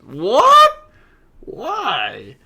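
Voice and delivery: very high, loud shocked shriek; shrieked what. Real, not acted. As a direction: yelp it out in total shock. Reacting to absurd chat.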